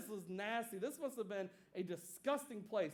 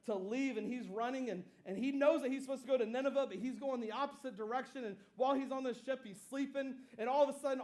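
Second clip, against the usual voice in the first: ordinary delivery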